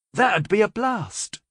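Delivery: bright and eager, slowed; gleeful enthusiasm; merry. A narrator's reading. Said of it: "Carilla said merrily".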